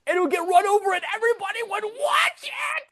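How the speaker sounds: very high shouted mock-menacing rant; Travolta. Movie fan mimicking Moose's rant at Todd, shouted Travolta impression. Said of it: "you sound just like Travolta in this movie".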